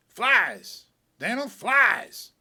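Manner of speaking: loud drawn-out call. Acted. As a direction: shout it to the frog, stretched. Calling out to the frog.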